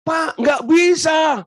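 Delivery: slow, high, loud cry of refusal; retold shout. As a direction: yell it out in despair, drawn out. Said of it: "Walaupun Ibu teriak seperti itu". (teriakan keras dan lambat, putus asa)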